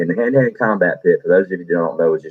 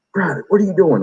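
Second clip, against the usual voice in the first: much higher sergeant voice; surprised drill sergeant; 11 semitones higher than usual. Retold or imitated. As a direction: bark the question at the private. Second drill sergeant finds recruit.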